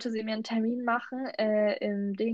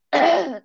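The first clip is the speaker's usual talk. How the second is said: clearing a scratchy throat; hard throat clear; 7 semitones higher, 11 dB louder than usual. Real, not acted. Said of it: "ich hab irgendwas im Hals".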